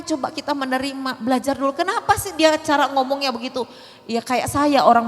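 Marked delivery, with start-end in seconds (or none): none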